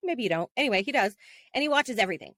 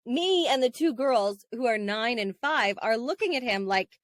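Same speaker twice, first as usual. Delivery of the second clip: normal talking voice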